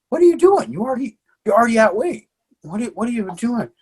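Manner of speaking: high-pitched, baffled mock challenge; incredulous questioning. Coach catching his fighter on the treadmill, incredulous. Confronting fighter in retold story.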